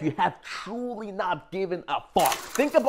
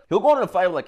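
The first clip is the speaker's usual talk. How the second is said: ordinary talk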